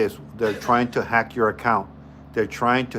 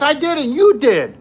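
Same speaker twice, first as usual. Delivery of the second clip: a high, louder defensive snap for the friend; 12 semitones higher, 6 dB louder, slower than usual. Retold or imitated. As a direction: push the blame back at him, high and indignant. Friend blames speaker in story.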